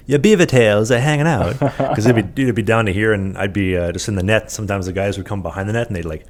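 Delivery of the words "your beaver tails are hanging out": slow, raised mock Swedish accent. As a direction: teammate teasing in a Swedish accent. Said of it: "like, a Swedish accent".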